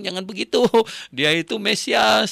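pleading protest. Retold or imitated. Preacher pleads with the man.

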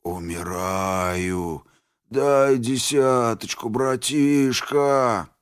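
slow, dragged-out pitiful moaning; whining. Acted. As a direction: whine like you're dying of man flu. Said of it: "заныл деструктор".

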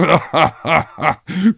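short rising chuckle. Acted. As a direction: laugh along pleased. Husband joins the laughter. (sevinçle kısa gülüş)

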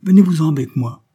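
high, loud eager summons; exalted. A narrator's reading. Geneviève urges the soldiers to follow her, exalted. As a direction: urge them along fervently. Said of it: "dit Geneviève avec exaltation".

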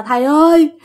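high, wailing imitation of begging; retold spirit pleading with the master; tearful pleading. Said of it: "bắt đầu bật khóc lên".

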